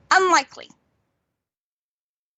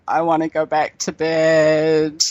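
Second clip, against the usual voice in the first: slow, droning, lower sleepy whine; lethargic half-asleep teenager; 4 semitones lower than usual. Retold or imitated. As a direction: imitate a teenager dragged out of sleep. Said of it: "very lethargic, very half asleep".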